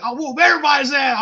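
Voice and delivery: put-on high, loud drunken threat; riled up. Drunk man threatening everybody after the fight, riled up. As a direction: act out a riled-up challenge. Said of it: "I was fucking riled up".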